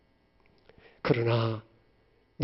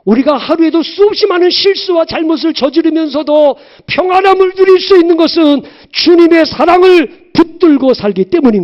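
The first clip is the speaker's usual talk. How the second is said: high, loud impassioned preaching; rising fervent sermon voice; preaching at full force; 11 semitones higher, 10 dB louder than usual. Acted. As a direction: cry it out from the pulpit.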